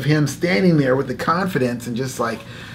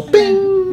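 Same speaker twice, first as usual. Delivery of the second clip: bell ding; 3 dB louder than usual. Retold or imitated. Carnival bell sound.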